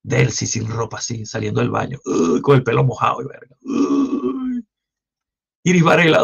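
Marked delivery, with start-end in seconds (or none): 3.6-4.7 mock disgust. Mock reaction to ugly politician.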